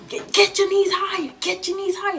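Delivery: high, loud mock drill shout; drill sergeant shouting. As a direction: yell orders at recruits. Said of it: "They be like".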